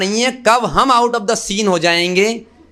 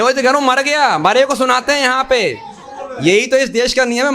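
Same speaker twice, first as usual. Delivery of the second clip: laughing; 7 semitones higher than usual. Real, not acted. Laughs at listener's remark.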